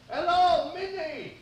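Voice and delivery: calling high through a letterbox; through a mail slot. Acted. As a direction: shout muffled through the door slot. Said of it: "shouting through the letterbox".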